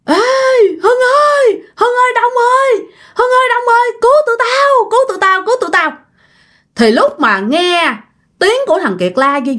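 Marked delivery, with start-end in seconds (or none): 0.0-5.9 panicked scream for help. Retold or imitated. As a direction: shriek for rescue in panic. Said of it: "Nó la quá trời luôn các bạn". (tiếng la thất thanh hoảng sợ kêu cứu)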